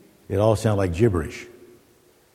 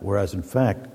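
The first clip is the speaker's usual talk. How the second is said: usual speaking voice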